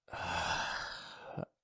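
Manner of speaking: long, soft exhale; heavy sigh. Acted. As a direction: let out a long regretful sigh. Regrets the outburst before apologizing.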